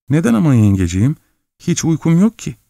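put-on high, quick young boy's plea; child's voice. A narrator's reading. Frightened boy asks his aunt.

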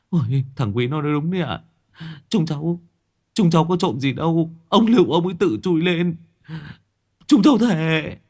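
high, hurried begging; pleading youths. Acted. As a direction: swear innocence to the old man. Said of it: "đồng thanh lạy". (mấy thằng lạy lục, giọng cao van xin)

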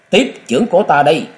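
quick fierce attack cry; battle shout. A narrator's reading. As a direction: shout it as you strike. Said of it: "Mụ vừa quát vừa vung hai chưởng".

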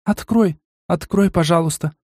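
высокий, умоляющий девичий голос; тихо упрашивает его; просьба. A narrator's reading. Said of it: "попросила меня Мила". (высоким голосом умоляет)

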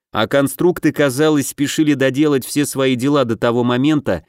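ordinary delivery, nothing marked

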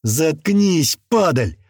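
loud, forceful hissed insult; venomous order to shut up; angry hiss. A narrator's reading. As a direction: hiss it right in her face. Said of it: "зашипел парень ей прямо в лицо".

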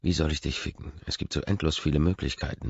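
ordinary delivery

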